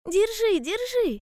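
very high, insistent cries; urgent hedgehog. A narrator's reading. Hedgehog urging bear cub to hold the line, urgent cry. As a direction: urge him on in a high hedgehog voice.

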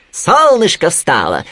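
high, drawn-out rabbit wake-up cry; exclaiming. A narrator's reading. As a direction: sing out the good news to rouse a sleeper. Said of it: "воскликнул кролик". (радостно и протяжно восклицая)

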